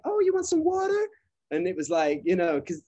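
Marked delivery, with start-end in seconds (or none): 0.0-1.1 very high, loud mock American server; American waitress. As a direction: imitate her sudden understanding in an American accent. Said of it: "She's like".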